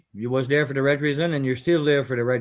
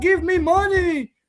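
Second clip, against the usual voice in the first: much higher mock whimpering voice, squealing the complaints; mocking crybaby whine; slower than usual. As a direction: mimic a whiner begging for money online in a high put-on wail. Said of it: "I was not crying online and say".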